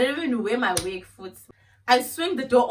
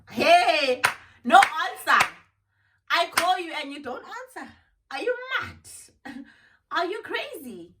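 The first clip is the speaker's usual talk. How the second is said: loud, raised-pitch indignation at the unanswered calls; angry rant; 6 semitones higher, 4 dB louder than usual. Real, not acted. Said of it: "I'm fuming".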